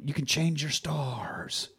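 slow, hushed and unvoiced, like a secret promise; fatherly whisper. Dad whispering slowly to his peasant son. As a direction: breathe it slowly to the boy.

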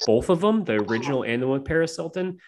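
usual speaking voice

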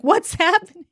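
high, laughing retold bewilderment; mock alarm. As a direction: cry out baffled, half laughing. Retold shock at guards pouncing.

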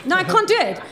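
ordinary talk

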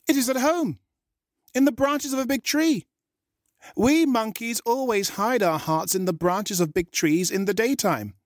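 high, quick cheeky monkey voice; a clever little monkey scolding in a squeaky voice; monkey tricking the shark, high and cheeky. A narrator's reading. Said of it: "Valentine's voices ... for the monkey".

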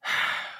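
short voiceless sigh; character's sigh. Acted. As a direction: let out a brief breathy sigh. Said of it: "sighs a bit".

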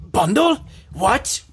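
high-pitched, incredulous anime villain; baffled outburst in a high cartoon warrior voice; Vegeta. Host acting out the poster's bundle discovery, high Vegeta impression. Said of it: "Why are you Vegeta now?".

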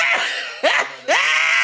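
high shrieking cackle; screeching laugh. Retold or imitated. Friend's voice memo gloating over the loss, shrieking laugh. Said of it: "them loud-ass screeches".

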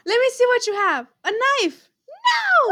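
high reenactment, leaping up on "No"; excited mock alarm. Player suddenly recalls the video, high mock alarm. Acting out recognised video dialogue.

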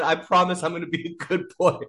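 put-on sheepish little-boy promise; pleading good boy. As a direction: plead like a scolded child. Retelling his plea.

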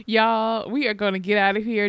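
ordinary talk, nothing marked

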